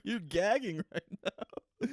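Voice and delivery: slow, high laughing words. Real, not acted. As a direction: laugh through the line. Laughing at friend gagging.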